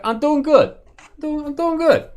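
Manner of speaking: imitating George. Teasing George about how he answered, squeaky mimic. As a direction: parrot him back in a silly squeak. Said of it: "Why'd you say it like that?".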